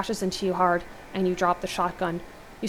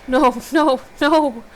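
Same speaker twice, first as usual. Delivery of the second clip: anguished cry, raised and loud; 6 semitones higher, 9 dB louder, much slower than usual. A narrator's reading. Narrator as hero finding Angela stabbed, crying out. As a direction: cry out in grief, building higher. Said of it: "You sob and drop to your knees and cry out".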